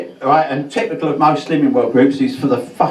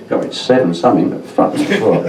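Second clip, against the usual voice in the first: ordinary delivery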